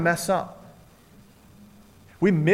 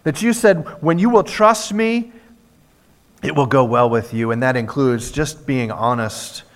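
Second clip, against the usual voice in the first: louder, pleading prayer voice; fervent prayer; 5 semitones lower, 7 dB louder than usual. Acted. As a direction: pray out for the wanderers. Leads the closing prayer.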